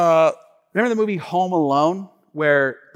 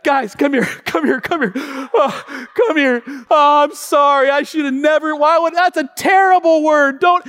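high, loud and urgent saying sorry; frantic apology; 10 semitones higher, 6 dB louder than usual. Retold or imitated. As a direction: rush to apologize to the kids. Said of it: "repenting and saying sorry".

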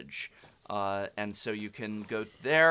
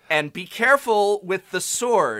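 anxious mock warning; 7 semitones higher, 5 dB louder than usual. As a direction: caution someone in a raised, louder voice. Acting out repeated on-set warning.

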